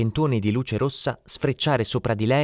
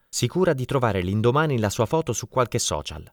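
plain reading voice

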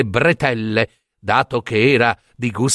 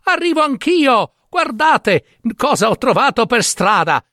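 high-pitched, gleeful announcement; bubbling simpleton calling out; excited calling; 11 semitones higher than usual. Acted. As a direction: show off a find to those ahead. Calling to brothers riding ahead.

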